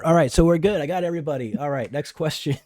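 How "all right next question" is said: usual speaking voice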